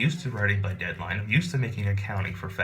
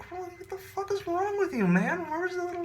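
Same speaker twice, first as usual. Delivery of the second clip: very high, whining mockery; mocking whiny voice; 3 dB quieter than usual. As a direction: whine it like a crybaby. Mocking the crying neighbor.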